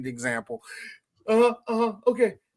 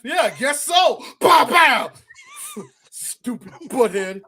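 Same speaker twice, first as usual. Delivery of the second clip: higher, louder shout with gun noises; mock shooting; 7 semitones higher, 6 dB louder than usual. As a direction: shout pow pow like firing. Acting Biff shooting McFly.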